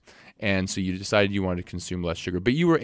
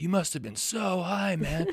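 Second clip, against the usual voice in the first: much higher, spaced-out stoner; 10 semitones higher, 4 dB quieter than usual. Retold or imitated. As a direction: imitate a stoned dude. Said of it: "people be like".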